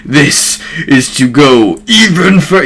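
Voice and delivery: slow, strained power-up yell, held back; mock scream. Naruto powering up against Madara, mock scream. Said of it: "He's basically screaming at this point".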